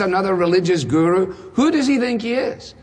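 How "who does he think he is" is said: high, scoffing question; incredulous sceptic voicing the doubt; mock disbelief. Mimicking doubters of Jesus.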